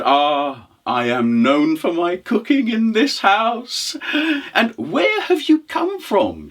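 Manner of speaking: high-pitched, lilting character voice; proud widow. Acted. Widow to the hungry traveller, proud then curious. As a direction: lift it high for the curious question.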